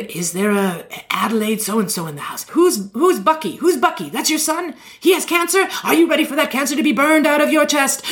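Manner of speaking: high, hammed-up faith-healer preaching; televangelist healing. Preacher casting the devil out of a woman's ears, hammed-up and high.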